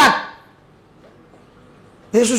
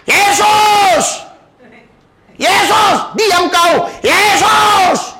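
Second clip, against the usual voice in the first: shouting Jesus; 7 semitones higher, much slower than usual. Retold or imitated. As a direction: cry out over the crowd. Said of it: "Tapi dia tambah teriak".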